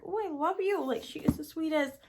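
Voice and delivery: high-pitched adoring gush; gushing fan reply. Retold or imitated. Retold gushing reply to author.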